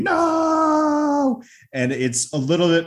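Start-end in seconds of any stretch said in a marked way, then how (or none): 0.0-1.4 high drawn-out mock scream of no. Neighbors screaming as the camera zooms, hammed-up. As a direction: shriek the no high and hold it. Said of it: "while they're screaming".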